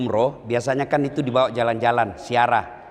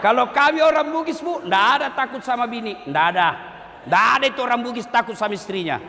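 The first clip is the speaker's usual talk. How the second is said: mock boast; 8 semitones higher, faster than usual. Boasting about Bugis men.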